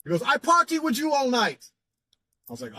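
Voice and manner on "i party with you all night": put-on high-pitched Jamaican accent; put-on Jamaican accent, high and sustained; Grim. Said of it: "Grim's accent was so thick".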